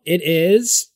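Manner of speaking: usual speaking voice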